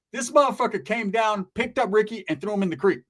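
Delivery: ordinary talk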